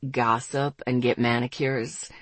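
low, slow teasing male voice; Ethan. A narrator's reading. Ethan ribbing the girl about girl time, low teasing. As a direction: tease slowly in a low register.

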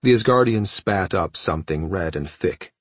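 ordinary delivery

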